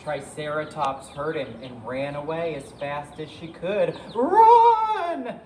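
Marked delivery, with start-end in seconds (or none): 4.1-5.4 high, loud stretched shout; panicked cry. Acted. Triceratops warns Ankylosaurus to flee, high alarmed cry. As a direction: cry out a warning at full pitch. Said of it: "she cried".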